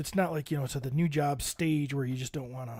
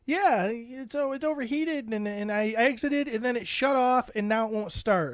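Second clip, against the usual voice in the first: higher, flustered wife voice; imitating his wife; 7 semitones higher than usual. His wife explaining the breakdown, higher and flustered. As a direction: tumble through the explanation. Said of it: "she's like".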